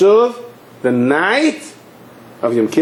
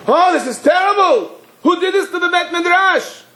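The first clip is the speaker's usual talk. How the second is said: very high, aghast exclamation; acted shriek of dismay; horrified outcry. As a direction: cry out in hammed-up shock. Hungover man wakes, sees the wreckage.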